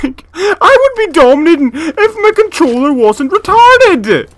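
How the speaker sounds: sustained high, very loud yelling; enraged shout. Real, not acted. Gamer blaming controller for losing, enraged shout. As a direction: roar the whole complaint at full force.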